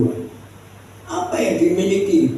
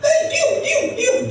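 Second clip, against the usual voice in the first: very high, acted crowd scolding; scolding hush. Crowd forbidding Bartimeus to shout, scolding. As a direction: order silence in a high, rapid cry.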